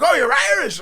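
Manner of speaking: high, loud imitation of a delighted crowd; retold joyful shout; mock excited welcome. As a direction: cheer it out like a whole pub. Retold pub crowd greets him.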